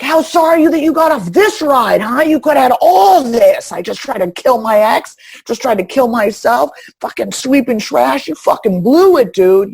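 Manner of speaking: high, loud sneering mock voice. Imagined ex mocks her sweeping trash, high loud sneer.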